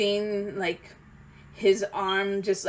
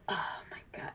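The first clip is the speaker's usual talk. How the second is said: soft breathless whisper; awed whisper; 15 dB quieter than usual. Real, not acted. Fan reacting to the moment in a hushed whisper. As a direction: barely breathe it out in awe.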